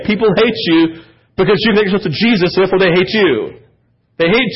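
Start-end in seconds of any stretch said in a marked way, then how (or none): none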